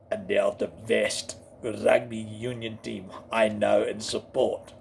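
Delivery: low Afrikaans-accented English; deep South African boast. Shown as a demonstration. Praising the Springboks, low South African accent.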